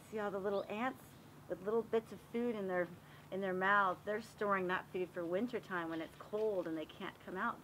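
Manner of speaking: usual speaking voice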